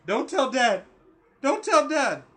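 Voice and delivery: loud, high imitation of a panicked kid; pleading child in background. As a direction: cry out a child's repeated plea. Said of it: "I hear him in the background".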